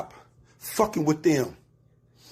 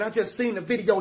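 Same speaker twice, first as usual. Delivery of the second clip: normal talking voice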